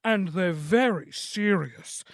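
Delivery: newsreader imitation. Shown as a demonstration. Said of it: "they talk like this".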